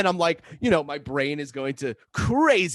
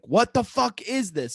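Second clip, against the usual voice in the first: higher, slowed, punched-out disbelief; retold exasperation; 7 semitones higher than usual. Brother reacting to sister's secret, high slow exasperation. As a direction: stretch out the baffled complaint.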